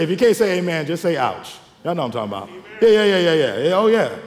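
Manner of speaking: ordinary delivery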